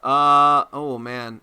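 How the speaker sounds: usual speaking voice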